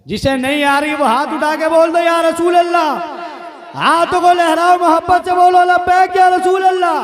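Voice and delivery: high, loud call to the crowd; rallying shout. Real, not acted. Preacher urging the crowd to raise hands and chant, rallying shout. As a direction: whip them up with a sustained high shout.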